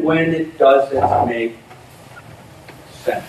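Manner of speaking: hushed emphasis. Real, not acted. Quiet emphatic close.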